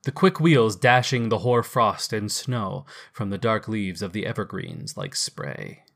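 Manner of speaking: usual speaking voice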